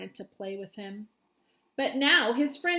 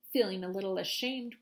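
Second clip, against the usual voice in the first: usual speaking voice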